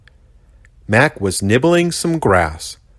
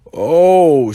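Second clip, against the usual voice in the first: scared little gulp, louder and higher; tiny creature's startled gulp; 9 semitones higher, 6 dB louder than usual. A narrator's reading. Bush baby gulping in fright at the huge rhino. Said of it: "she gulped".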